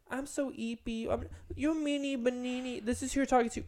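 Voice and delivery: high, babyish mock voice. Mocking cutesy TikTok posters in a babyish voice. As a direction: sneer it like a cutesy baby-talk TikTok. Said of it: "I hate the, like".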